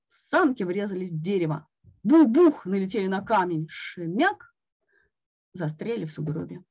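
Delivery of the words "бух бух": loud, punchy bang-bang; crash sound. A narrator's reading. Narrator voicing the sled crashing into trees, loud. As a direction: stamp out the crash noise.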